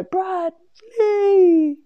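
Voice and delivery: high, loud, long-drawn name call; teasing family call. Retold or imitated. Dad and sister replay the story at home, teasing call. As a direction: imitate relatives hollering a name in mockery. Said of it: "my dad and my sister just go".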